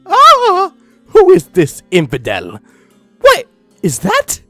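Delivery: loud, high-pitched mock shock; hammed-up startled villain; alarmed outcry. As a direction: cry out at a sudden intruder. Story villains startled by intruder.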